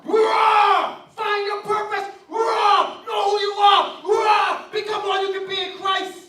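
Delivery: loud, high, shouted sermon cries of roar; rally the congregation, shouting each line; preaching at full force. Acted. Preacher urging the children to find their roar, shouting.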